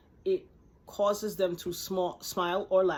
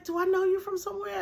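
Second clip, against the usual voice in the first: high-pitched, louder puzzled question, acted out; chuckling puzzlement; 12 semitones higher, 5 dB louder, faster than usual. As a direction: re-enact amused confusion at a stranger. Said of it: "had this little chuckle on my face".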